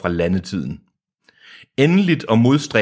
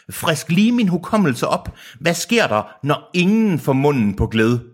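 high, theatrical villain voice; mock-puzzled, much higher character voice; acted villain question; 7 semitones higher than usual. A narrator's reading. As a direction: pitch the question high and put-on. Villain quote in audiobook reading.

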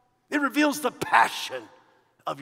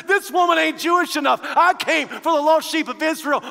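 louder, half-laughing mock quote of Jesus; 6 semitones higher, 6 dB louder than usual. Preacher mocking Jesus's words to the crowd, louder and laughing. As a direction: deliver it like a cheeky punchline. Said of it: "He says".

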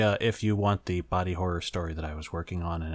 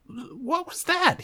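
much higher, slow, stunned question; flustered; 4 dB louder, much slower than usual. Acted. Yamato to Sora after the kiss, flustered and high. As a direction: caught off guard, words drawn out. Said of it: "he asked, flustered".

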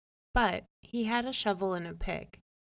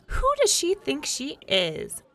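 high, loud, outraged; huffy wife, much higher and louder; indignant protest; 9 semitones higher, 7 dB louder than usual. A narrator's reading. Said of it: "protested Jamel".

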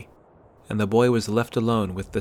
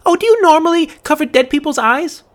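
loud, much higher indignant demand; 9 dB louder than usual. A narrator's reading. Girl confronts the boy, loud indignant demand. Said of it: "she demanded".

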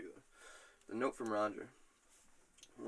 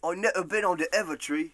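put-on British accent, higher and louder; 5 semitones higher, 6 dB louder than usual. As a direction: do a hammed-up British voice. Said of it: "sad I had to do that accent".

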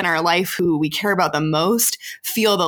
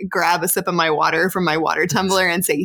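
ordinary talk

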